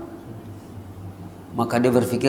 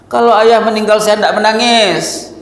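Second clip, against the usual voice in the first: put-on high, louder child protesting to his father; child's voice; 8 semitones higher, 4 dB louder than usual. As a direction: speak up like a hurt little boy. Preacher voices the crying child.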